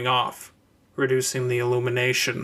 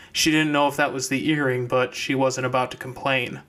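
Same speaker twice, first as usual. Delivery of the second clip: ordinary delivery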